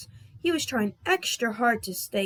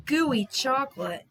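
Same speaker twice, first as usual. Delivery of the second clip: usual speaking voice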